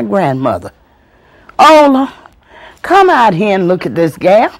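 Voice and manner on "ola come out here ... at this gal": loud call to wife. Retold or imitated. Granddaddy calling his wife to come see, loud.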